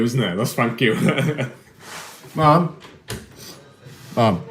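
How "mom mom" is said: higher, louder call to mom in the next room. Real, not acted. Calling to mom in another room.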